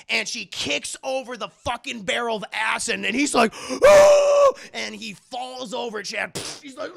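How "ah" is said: very high, loud sustained shriek; mock scream. Man hit by acid, high loud mock scream.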